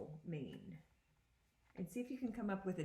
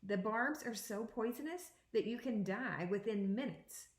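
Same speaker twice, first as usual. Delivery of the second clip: put-on expressive read-aloud to children; picture book read to students; reading aloud to a class; 3 semitones higher, 3 dB louder than usual. Teacher reads picture book aloud.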